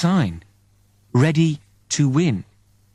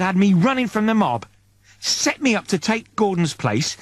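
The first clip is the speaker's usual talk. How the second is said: angry Eddie; 7 semitones higher than usual. Acted. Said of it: "Eddie's getting angry now".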